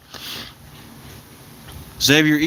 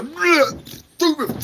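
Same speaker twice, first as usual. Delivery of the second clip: high, slurred drunk imitation; mock a belligerent drunk falling about; imitating a sloppy drunk; 13 semitones higher, much slower than usual. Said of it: "they're like".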